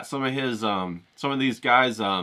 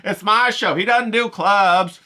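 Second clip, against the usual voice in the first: high, loud, cocky taunt, with a slow, drawn-out "Terms of service"; brazen cameraman; 9 semitones higher, 4 dB louder, slower than usual. Retold or imitated. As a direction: sass the host like a cheeky sidekick. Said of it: "acting all brazen".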